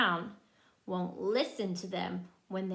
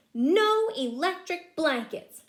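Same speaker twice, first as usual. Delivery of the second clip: loud, higher-pitched stern no; gruff refusal; 6 semitones higher, 6 dB louder than usual. Acted. Farmer Brown refuses the cows, loud and cross. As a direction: slam the door on the idea. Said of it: "said Farmer Brown".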